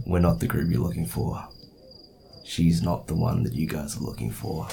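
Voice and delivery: soft, understated threat; subtly menacing. Acted. Player trying the line on the guard captain, subtly menacing. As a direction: keep it low-key and quiet, lightly menacing. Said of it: "in a subtle sort of menacing way".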